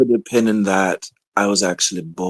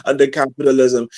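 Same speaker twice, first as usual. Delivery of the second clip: laughing while talking; 5 semitones higher, 4 dB louder than usual. Real, not acted. Laughs through her rebuttal.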